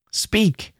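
high-pitched one-word command; menacing order. A narrator's reading. Girl with her face devilishly near his, menacing order. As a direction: order him to answer. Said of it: "putting her face devilishly near his".

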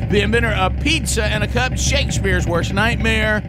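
rising, booming ring-announcer intro; grand entrance announcement. Acted. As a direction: build it up and shout the name. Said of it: "Ladies and gentlemen, put your hands together".

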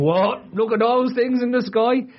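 high, slow cry of amazement; mad Irish lad. Acted. Mocking a lad on a mountain spotting UFOs, mad Irish lad. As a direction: gawp up at the sky as a wild-eyed lad. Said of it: "some mad Irish lad up a mountain going".

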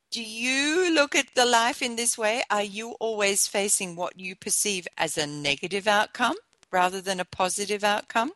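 ordinary delivery

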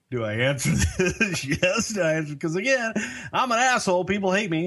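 high-pitched, laughing through the words. Real, not acted. Storyteller laughing about being hated, laughing. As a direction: crack up while telling your own dilemma.

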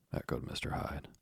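usual speaking voice

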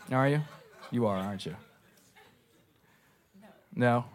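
ordinary talk

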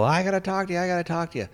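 high-pitched mock insistence; urgent pleading. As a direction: imitate someone held back and demanding attention. John held back, calling to the senator.

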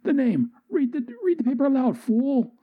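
high, fast shrieking villain; high shrill henchman; shrill demand. Acted. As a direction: squawk the order at him. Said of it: "Calgary's like".

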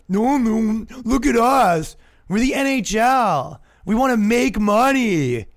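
high, loud put-on pleading voice; stretched, overdone corporate denial; mock protesting league.

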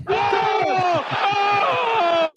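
high, long shouted call. Acted. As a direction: belt it like a stadium chant. Shouted N C State joke.

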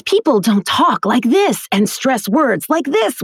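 mock stagey delivery, artificially punching words like THIS; over-stressed word acting. Shown as a demonstration. Teacher showing students how people don't talk, over-stressed. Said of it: "stop acting the words".

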